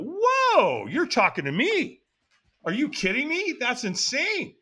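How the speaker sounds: high, wide-eyed "whoa" of discovery; amazed realization. Retold or imitated. Amazed revelation, retold.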